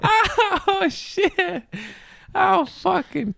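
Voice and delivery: high, breaking-up laugh through the words; can't stop laughing as you say it; laughing helplessly. Real, not acted. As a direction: squeal it out mid-giggle fit. Cracking up again.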